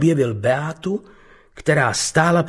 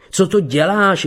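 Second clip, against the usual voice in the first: cry out; 7 semitones higher than usual. Acted. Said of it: "vykřikl".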